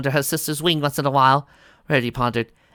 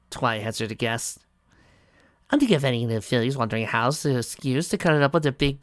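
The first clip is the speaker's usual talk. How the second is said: ordinary talk, nothing marked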